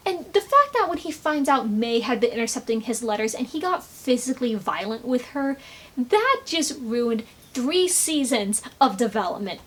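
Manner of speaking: ordinary delivery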